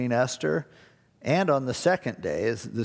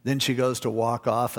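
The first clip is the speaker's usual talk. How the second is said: ordinary delivery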